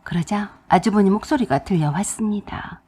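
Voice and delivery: ordinary delivery, nothing marked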